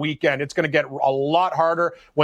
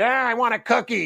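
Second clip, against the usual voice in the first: mock whiny little kid, slightly higher; 5 semitones higher than usual. Mocking Spieth as a whiny kid, lightly voiced. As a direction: toss off a childish whine, lightly. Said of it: "People don't know he's like that kid".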